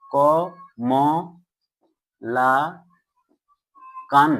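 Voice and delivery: "ক, ম, লা, কান" with long pauses between; syllable by syllable. Shown as a demonstration. Teacher showing pupils the syllables, "ক, ম, লা, কান" each separate. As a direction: sound out "ক, ম, লা, কান" one beat at a time. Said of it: "সর্বপ্রথমে প্রয়াসে কতটুকু তুমি উচ্চারণ".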